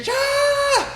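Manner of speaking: very high screamed kiai; throat scream. Shown as a demonstration. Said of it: "go for my throat and scream".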